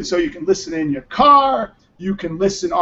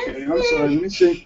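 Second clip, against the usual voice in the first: consoling father; faster than usual. Real, not acted. Father consoling crying child.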